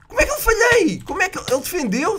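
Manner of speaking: very high-pitched disbelief; baffled, exasperated high cry; incredulous outcry. Real, not acted. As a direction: squeal in protest at the miss. Missed his shot again.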